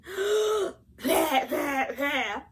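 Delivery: big gasp, then loud mock coughs; coughing with an inhale. Shown as a demonstration. Wife showing how a normal cough starts, gasp then loud fake hacking. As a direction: suck in air, then hack it out in bursts. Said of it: "But rather than going".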